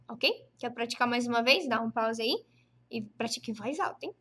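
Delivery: usual speaking voice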